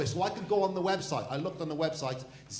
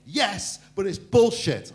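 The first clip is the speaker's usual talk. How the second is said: louder, higher imitation of an aggrieved man; mock indignant protest; 3 semitones higher, 8 dB louder, slower than usual. Man in the audience answers the comedian, mock indignant. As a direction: blurt it out as a retold complaint. Heckler's protest retold on stage.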